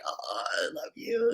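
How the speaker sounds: put-on high, very soft little-child voice; hushed, tender four-year-old; little kid. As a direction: tell her you love her like a tiny kid. Little child hugging grandma.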